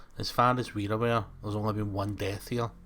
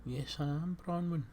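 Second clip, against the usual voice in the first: put-on Welsh accent; 6 semitones higher, 7 dB quieter than usual. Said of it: "change to a Welsh voice when I say Bronwyn".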